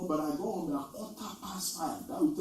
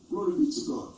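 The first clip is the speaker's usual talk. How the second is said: ordinary talk, nothing marked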